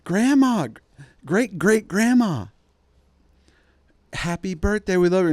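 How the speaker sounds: high, loud singsong greeting to an ancient grandma; hammed-up relatives calling out to great great grandma; mock cheery family. Relatives loudly wishing the ancient grandma happy birthday, in a hammed-up high voice.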